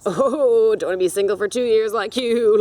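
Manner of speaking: sneering impression of the date's insult; mocking the date. Loud mocking imitation of the date's remark. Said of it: "Fucking asshole".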